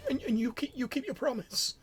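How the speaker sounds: put-on high, hopeful old voice; frail old man. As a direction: ask with timid hope.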